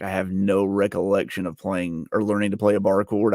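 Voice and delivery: ordinary talk, nothing marked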